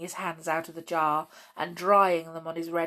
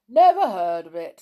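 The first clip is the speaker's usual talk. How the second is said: loud, blunt bear voices; three bears answer as one; loud bear chorus; 10 dB louder than usual. Acted. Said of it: "said the three bears, all together".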